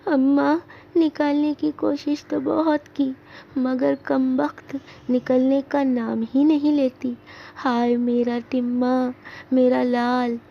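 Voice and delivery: high, weepy lament; tearful voice. Acted. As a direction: wail for her stuck son. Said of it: "नसीबुन रोनी आवाज़ में".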